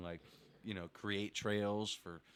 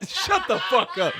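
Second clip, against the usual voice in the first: high, loud, laughing yell; mock-outraged shout; laughing outburst; 12 semitones higher, 13 dB louder than usual. Real, not acted. Host outraged at a teasing joke.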